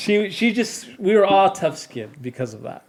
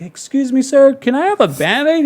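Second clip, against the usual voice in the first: put-on much higher, small polite child voice; polite little kid; 10 semitones higher, 3 dB louder than usual. Injured boy asks a passing ranger, politely high-voiced. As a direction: ask the stranger sweetly, like a little boy.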